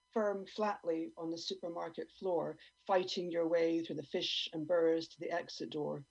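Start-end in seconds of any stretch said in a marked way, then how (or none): none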